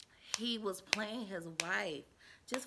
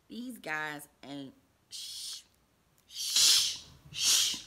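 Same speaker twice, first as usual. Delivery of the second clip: self censor shush; 5 semitones lower, 5 dB louder, much slower than usual. Real, not acted. Censoring a swear.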